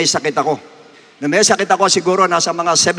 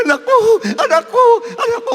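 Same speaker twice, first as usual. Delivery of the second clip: high wailing cries, climbing higher each time; mother crying out for her son, sobbing and climbing in pitch; imitating a crying mother; faster than usual. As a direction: wail like a panicked mother over her child. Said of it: "Nag-iiyak yung lahat ko".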